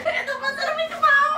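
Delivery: high squeaky laughing voice; helium voice. Real, not acted.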